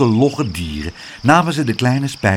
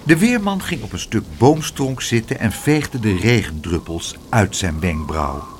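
ordinary delivery

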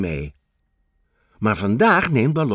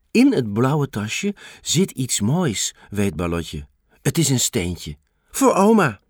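plain reading voice

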